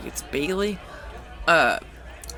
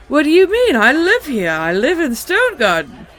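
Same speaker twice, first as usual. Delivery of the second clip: high and loud, hammed-up elf squeal; horrible high elf voice; 9 semitones higher, 7 dB louder than usual. Narrating the recap, voicing the elf on the stone: high shrill elf. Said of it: "in a horrible high elf voice".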